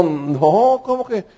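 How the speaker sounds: a hint of a vain, put-out angel; jealous angel. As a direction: whine a little about being left out. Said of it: "Uno de ellos dijo".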